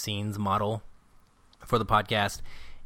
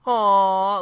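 high, loud doting aw; loud high melting aw; imitating the driver; 11 semitones higher, 11 dB louder than usual. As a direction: coo at the otters. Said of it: "He goes".

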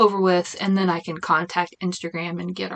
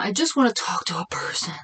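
whisper; faster than usual. Real, not acted. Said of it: "I get all excited".

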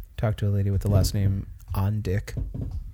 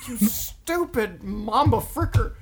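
slow, high cartoon insult; goofy insult voice; 10 semitones higher, much slower than usual. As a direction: drag it out like a cartoon.

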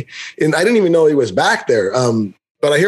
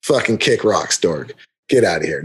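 dismissive brush-off; 8 semitones lower than usual. Retold or imitated. Homeowner refusing the ball guy, low dismissal. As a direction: send him off with low contempt.

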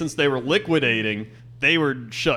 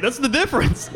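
laughing; 7 semitones higher, 4 dB quieter than usual. Retold or imitated. Laughing while retelling sassy reply.

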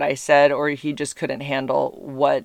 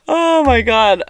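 laughing; 10 semitones higher, 6 dB louder, slower than usual. Real, not acted. Laughing after funny phone call.

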